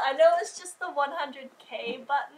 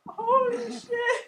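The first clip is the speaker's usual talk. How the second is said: crying; 11 semitones higher, 6 dB louder, slower than usual. Real, not acted. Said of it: "I'm crying over a fucking silver".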